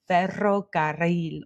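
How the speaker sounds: "ferrocarril" with both double Rs trilled; "ferrocarril" in Spanish, tongue trilling on each rr; rolled Spanish R. Shown as a demonstration. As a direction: roll the Rs in "ferrocarril". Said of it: "words that have the R in them".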